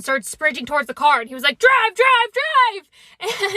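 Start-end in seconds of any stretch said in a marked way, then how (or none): 1.6-3.5 high, loud urgent yelling; frantic getaway shout, pitched way up; panicked shout. Retold or imitated. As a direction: yell at them to floor it. Friend fleeing yells at them.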